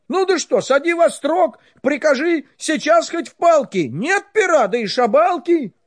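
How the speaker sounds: loud protest. Acted. Ivan protests to tsar.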